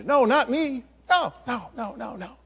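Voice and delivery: high panicky no's fading to almost nothing; frightened denial. Acted. Peter denying again, fading.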